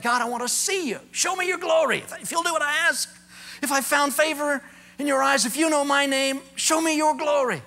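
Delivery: high, loud, urgent pleading; impassioned plea. Retold or imitated. Moses crying out to God in urgent pleading. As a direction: beg for the glory with everything.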